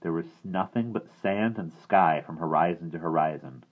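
ordinary delivery, nothing marked

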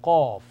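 Arabic letter sound demo; deep rounded qaf. Shown as a demonstration. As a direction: hit Qaf hard and rounded from deep in the throat. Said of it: "A rounded sound".

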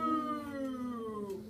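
a drawn-out lowing moo; cow moo. Acted. Puppy George moos at his mother like a cow. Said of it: "George went".

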